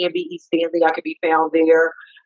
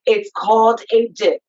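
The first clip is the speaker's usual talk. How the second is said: ordinary talk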